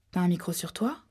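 ordinary delivery

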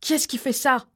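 screamed. A narrator's reading. Man yelling at Rita as the kitchen shakes, screaming. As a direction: bellow it across the kitchen. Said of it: "l'homme hurla à plein poumon".

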